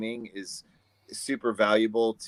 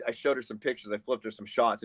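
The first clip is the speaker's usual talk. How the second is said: ordinary talk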